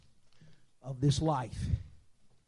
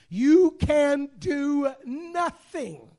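loud, slow, higher declaration; preaching climax; 11 semitones higher, 11 dB louder, much slower than usual. Acted. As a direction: hammer it home, slowly and loud.

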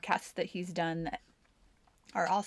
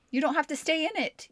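emphatic, higher; 9 semitones higher, 4 dB louder than usual. Real, not acted. Repeated higher and louder.

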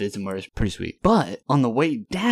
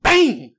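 high, loud burst of a bang; imitated impact bang; 14 semitones higher, 7 dB louder than usual. Storyteller imitating his car hitting the pigeon, loud bang. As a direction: punch out the crash noise.

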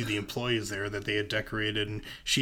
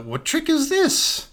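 acted wary astonishment, slow and high; incredulous question; 7 dB louder, slower than usual. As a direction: pitch soaring high, drawn-out disbelief. Acted suspicious greeting at the door.

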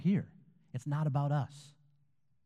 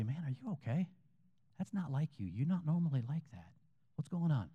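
softer, higher, caring check-in; gentle concern; 4 semitones higher, 3 dB quieter than usual. Acted. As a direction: ask with soft, sincere concern. Models a caring response to someone.

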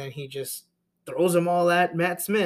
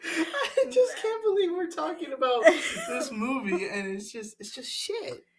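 high, breaking up with real laughter; giggling disbelief, trailing off; laughing; 13 semitones higher than usual. Real, not acted. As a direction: laugh through the complaint. Laughing about the bad movie.

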